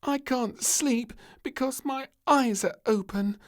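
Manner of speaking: high, loud whiny moan.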